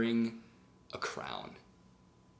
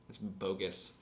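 ordinary talk, nothing marked

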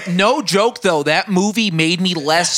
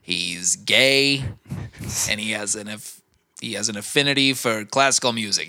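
low, quiet Dafoe voice; Willem Dafoe impression; 7 semitones lower, 5 dB quieter than usual. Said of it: "and he's like".

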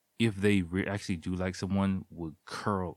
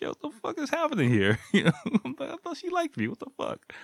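much higher, laughing through it; laughing disbelief; 4 dB louder, faster than usual. Retold or imitated. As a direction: laugh while asking what's happening. Retelling confusion, laughing.

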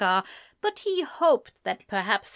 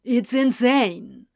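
dismissive; 3 semitones higher, 6 dB louder, slower than usual. A narrator's reading. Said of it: "he retorted".

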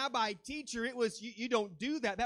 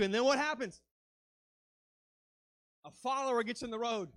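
ordinary delivery

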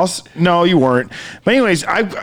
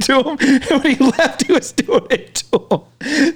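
laughing; 8 semitones higher than usual. Real, not acted. Laughs while telling.

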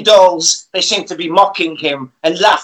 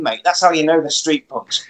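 ordinary talk, nothing marked

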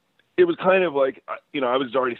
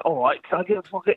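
a hammed-up fake British accent, pitched much higher; a teen ordering chips in a put-on British accent; 11 semitones higher than usual. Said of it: "with a fake accent".

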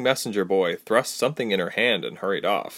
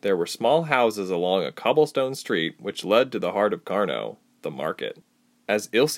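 plain reading voice

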